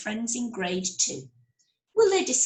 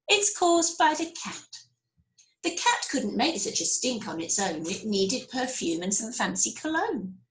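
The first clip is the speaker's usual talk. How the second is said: usual speaking voice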